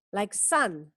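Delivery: ordinary talk, nothing marked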